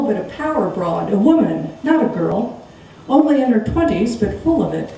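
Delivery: plain reading voice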